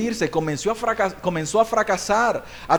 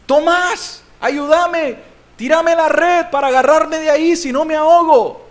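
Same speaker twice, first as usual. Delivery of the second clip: high, urgent mock plea; calling for help; 7 semitones higher, 4 dB louder than usual. Peter begging Tomás to throw the net, calling out. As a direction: shout across to a friend while drowning. Imagined drowning Peter calls his friend.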